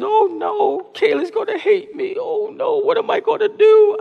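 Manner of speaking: put-on high, tearful child voice; mock sobbing kid's high wail; child crying. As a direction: play the panicked little girl near tears. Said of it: "Kira started crying".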